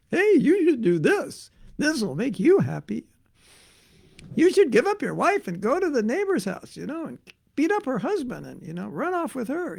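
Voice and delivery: high, wheedling tempter; whisper-sell it like a little devil, but voiced and high; little thought character. A little thought character tempting the listener, high and wheedling. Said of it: "These little characters come".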